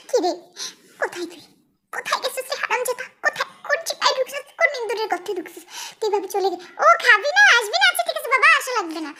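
high, hurried phone scolding; angry mother. Acted. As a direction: rant at your runaway son, pitch jumping up. Mother rants at son on phone.

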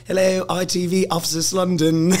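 put-on hammy London English accent on the phone; terrible English accent. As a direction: badly fake an English office receptionist. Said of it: "My English accent's terrible".

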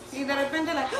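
usual speaking voice

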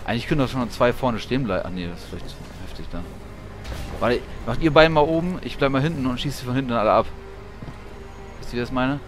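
ordinary talk

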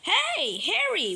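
high pretend hail; calling out. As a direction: call over to a friend toy. Calls to Harry.

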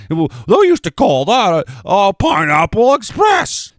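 high, slow, loud mock announcement; sarcastic showman bellow; mocking old term. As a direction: ham up the old-timey name.

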